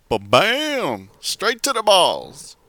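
high, slow mock announcer call; quoting the caller. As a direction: ham it up like a hype call. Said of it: "The man said".

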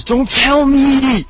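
high drawn-out mock panic; panicked plea. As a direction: beg them not to spoil it. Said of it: "the standby goes".

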